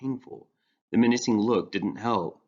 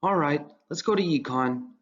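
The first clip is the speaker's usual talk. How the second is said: put-on higher, eager young voice; earnest teen boy; 6 semitones higher than usual. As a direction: play Ryan bright and earnest. Ryan's eager voice.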